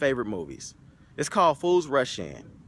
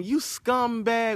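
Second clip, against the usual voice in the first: mock yell; 8 semitones higher, much slower than usual. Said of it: "leaving the old guy yelling".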